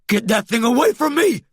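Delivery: high, frantic outcry; panicked howl. A narrator's reading. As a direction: howl to keep it away. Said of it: "Maglev howled".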